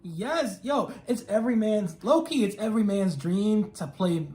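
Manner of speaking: ordinary talk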